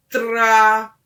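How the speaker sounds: "tra" alto e prolongado; imitação de som. Shown as a demonstration. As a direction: solte um "tra" forte e sustentado. Demonstrates a sound for the child. (som "tra" alto, de demonstração)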